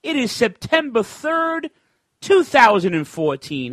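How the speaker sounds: high, slow, loud announcer read; hyped radio intro, high and drawn out; radio host intro. Acted. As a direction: announce the show like a DJ.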